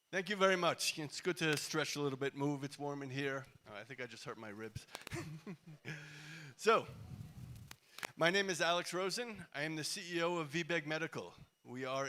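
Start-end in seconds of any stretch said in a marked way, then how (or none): 4.9-6.5 soft chuckle. Real, not acted. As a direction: laugh softly at your own mishap. Jokes about hurting his ribs.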